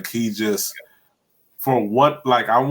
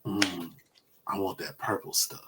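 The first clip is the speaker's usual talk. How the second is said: hushed craving; 3 semitones lower, 12 dB quieter than usual. Retold or imitated. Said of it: "He's all like".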